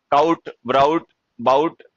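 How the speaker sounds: काउट ब्राउट बाउट said with an ow sound, mocking; mocking wrong pronunciation. Teacher mocking a student's wrong ow pronunciation of brought. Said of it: "ब्राउट नहीं होता देहाती".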